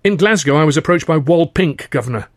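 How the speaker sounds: blurted out. Acted. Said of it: "I found myself blurting out".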